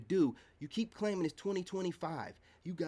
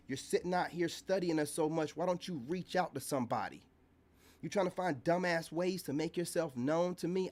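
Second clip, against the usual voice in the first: usual speaking voice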